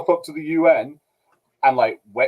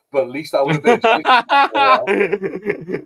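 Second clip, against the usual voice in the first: laughing; 6 semitones higher, slower than usual. Real, not acted. Laughing at own joke.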